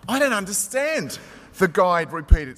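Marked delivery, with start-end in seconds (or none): 0.1-1.2 higher-pitched puzzled protest; a touch of sullen bafflement; baffled, sullen. A narrator's reading. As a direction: sound mildly baffled, voice pitched up. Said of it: "somewhat baffled, somewhat sullen".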